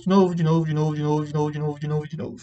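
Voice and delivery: droning chant that gradually fades; monotonous repetition. A narrator's reading. Narrator listing endless failed attempts, monotonous repetition. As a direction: repeat it on one low, unvarying note, trailing away. Repeated expulsions listed on and on.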